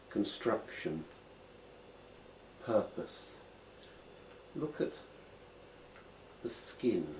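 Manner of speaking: unhurried meditation guide; guided contemplation. Acted. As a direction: lead them gently into looking closely. Guides a slow finger contemplation.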